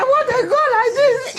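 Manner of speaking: high, laughing cry of disbelief; half laugh, half wail; laughing retell. Retold or imitated. Mother retelling the station scene, laughing in disbelief.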